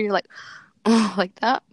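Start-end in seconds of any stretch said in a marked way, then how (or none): 0.8-1.2 loud frustrated sigh-groan. Shown as a demonstration. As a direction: demonstrate a short exasperated "ugh". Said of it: "you know how when you're frustrated".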